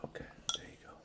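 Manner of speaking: soft whispered encouragement; whispering. Real, not acted. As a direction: whisper it gently. Parent whispers while feeding.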